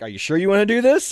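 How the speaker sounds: a playful drill sergeant's warning; mock stern warning. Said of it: "He'd be like".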